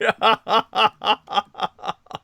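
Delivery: high, loud laugh breaking out; burst of laughter. Real, not acted. Host laughing hard at a viewer's cheeky comment. Joke about tech support accent.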